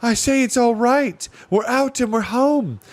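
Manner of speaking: shouting up high, thrilled; joyful girl shouting down a hole; excited shout. A narrator's reading. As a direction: call down to friends below. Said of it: "to shout down to the others".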